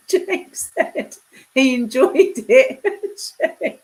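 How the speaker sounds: loud, high, real laughing fit; helpless laughter. Real, not acted. Streamer reading Jake's cheeky reply, in fits of laughter. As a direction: squeeze the words out slowly through laughter.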